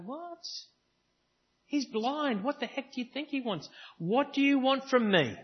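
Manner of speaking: mock exasperation at an obvious question; incredulous. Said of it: "which I still kind of go".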